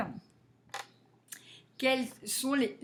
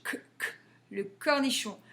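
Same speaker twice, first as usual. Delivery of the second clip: ordinary delivery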